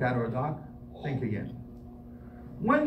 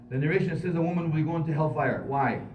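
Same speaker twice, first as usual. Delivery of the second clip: ordinary talk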